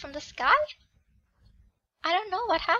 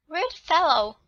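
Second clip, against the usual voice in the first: a touch louder, looking down on her; haughty young noble; 9 dB louder, slower than usual. Acted. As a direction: clip it like a spoiled aristocrat. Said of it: "He stares at me and says".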